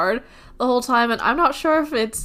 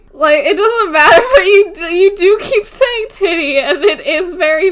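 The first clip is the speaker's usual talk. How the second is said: real laugh; 6 semitones higher, 8 dB louder than usual. Real, not acted. Laughing at cohost's mispronunciation.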